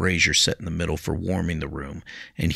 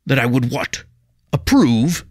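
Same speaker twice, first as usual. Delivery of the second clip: louder, firm lecturing rebuke; stern teacher Jiro; 8 semitones higher, 8 dB louder than usual. A narrator's reading. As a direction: lay down the law to him. Jiro scolds pupil.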